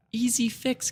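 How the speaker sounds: squeaky high boast; falsetto brag. Pretend. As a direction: say it in a silly high voice.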